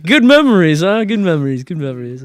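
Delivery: mock cheer, high and loud, then fading; teasing buddy. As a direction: imitate a pal gleefully ribbing you.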